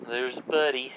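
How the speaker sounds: ordinary talk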